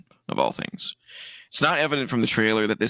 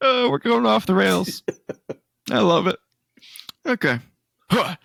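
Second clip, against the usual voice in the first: high, loud, delighted wheeze; giddy from laughing; 10 semitones higher, 3 dB louder than usual. Real, not acted. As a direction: squeal it out, tickled by the chaos. Helpless after a laughing fit.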